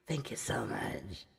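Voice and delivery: whispered low and husky; husky whispered thanks to Dave; breathy husky whisper. Acted. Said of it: "husky voice".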